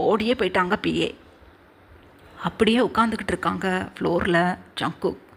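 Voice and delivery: normal talking voice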